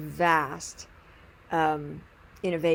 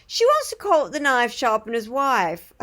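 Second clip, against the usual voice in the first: louder, higher British punchline; British accent; 4 semitones higher, 5 dB louder than usual. Retold or imitated. Knife sharpener naming his wife's book, British accent. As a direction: deliver it in a put-on English accent. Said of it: "I think he's English".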